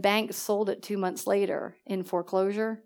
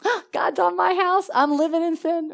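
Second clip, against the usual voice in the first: higher, half-laughing mock alarm; mock panic; 8 semitones higher than usual. She jokingly voices her own guilty alarm, higher and laughing. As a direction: play up the panic as a joke. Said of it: "I was like".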